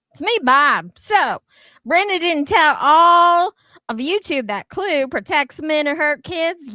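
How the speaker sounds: loud indignant callout. Real, not acted. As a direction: call her out loudly, fired up.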